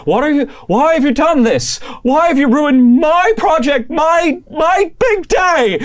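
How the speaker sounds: very high and loud, slowing at the end; Mallory raging at the ruin; furious shout. Acted. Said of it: "Mallory's just there just going like".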